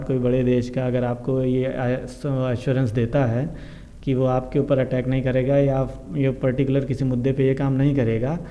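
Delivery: ordinary talk, nothing marked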